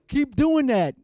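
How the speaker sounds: high, loud encouraging call; hype cheer. Retold or imitated. Club guy urging the dancer, high loud call. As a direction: egg the dancer on loudly. Club dancing instructions retold.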